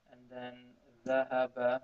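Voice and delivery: ordinary talk, nothing marked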